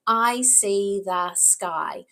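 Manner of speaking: slow, evenly separated words. Shown as a demonstration. Teacher asks child to count words, slow and segmented. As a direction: leave space round each word. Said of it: "How many words did I say?".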